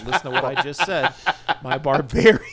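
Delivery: loud laughing self-mockery. Real, not acted. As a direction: laugh at yourself. Laughing at own slip.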